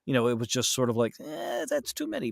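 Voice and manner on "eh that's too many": high, soft, unimpressed "eh"; mock bored listener waving it away; dismissive shrug. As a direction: brush it off like it's no big deal. Dismissive imitated reaction.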